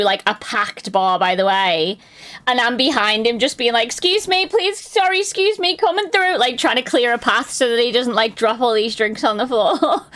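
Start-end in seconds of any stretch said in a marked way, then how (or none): 3.8-6.4 high-pitched apologetic crowd-weaving; acted polite urgency. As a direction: squeeze past people with quick, higher apologies. Clearing path through crowded bar.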